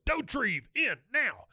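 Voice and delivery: high urgent call. Retold or imitated. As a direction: summon him from across the room. Officer summoning Bill urgently.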